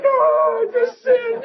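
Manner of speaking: high-pitched imitated crying wail; hammed-up, high tearful confession; mock tearful confession. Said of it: "I'm crying in the men's room".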